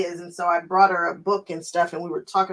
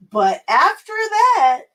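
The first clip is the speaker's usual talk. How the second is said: much higher, louder, slow squeal; excited squeal; 11 semitones higher, 5 dB louder, much slower than usual. Real, not acted. Vlogger gushes to viewers about buying more fabric. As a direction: squeal it out with glee. Excited reaction to own confession.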